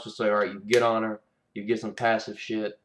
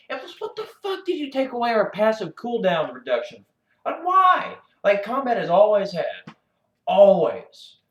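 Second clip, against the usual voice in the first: much higher and louder, indignant; indignant outburst; 8 semitones higher, 6 dB louder than usual. Real, not acted. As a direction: demand why they took it away. Indignant rant peaks.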